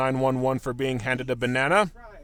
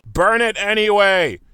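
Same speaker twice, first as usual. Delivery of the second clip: loud, slow shout; 5 semitones higher, 9 dB louder, slower than usual. Real, not acted. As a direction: yell the order. Shouting at the skit.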